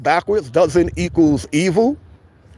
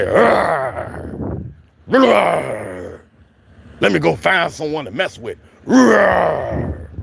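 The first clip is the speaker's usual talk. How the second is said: grumpy growl like a bear; angry growling; much slower than usual. Retold or imitated. Man mocking people who wake up angry, growling. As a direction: growl it out, mock monster. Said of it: "They just wake up and say".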